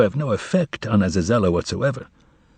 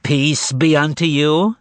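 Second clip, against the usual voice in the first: higher, slow, nasal greeting; 6 semitones higher, slower than usual. Acted. Azazello at the window, a slow nasal greeting. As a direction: pinch the voice through the nose. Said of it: "a nasal voice was heard at the window".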